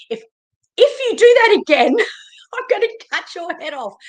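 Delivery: high, fast, hammed-up threat; mock angry threat. Angry at her lead generation people, a hammed-up high threat. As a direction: squeal it out as a joking outburst. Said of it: "get really angry at my".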